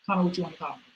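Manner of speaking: normal talking voice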